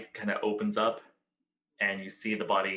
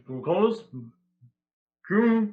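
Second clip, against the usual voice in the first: higher, louder muffled hello; 8 semitones higher, 7 dB louder than usual. Acted. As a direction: call through something muffled. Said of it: "it's real muffled".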